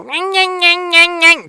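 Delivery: very high nasal-free nyang nyang mockery; whiny nagging nyang. Mocking a nagging woman, very high whiny nyang. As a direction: squeal it like endless nagging. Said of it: "listen to someone like that".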